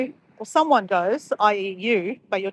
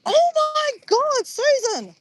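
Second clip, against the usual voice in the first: excited; 14 semitones higher, slower than usual. Real, not acted. Excited gasp at Paris news.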